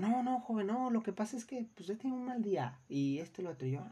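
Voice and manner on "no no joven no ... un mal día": high, hurried excuse in a customer's voice; mock high-pitched customer apologizing; apologetic customer. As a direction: play the client backing down at once. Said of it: "otros clientes que enseguida dicen".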